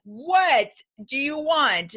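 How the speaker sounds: irritated shopper to pushy salesman; hammed-up exasperation; mock annoyed.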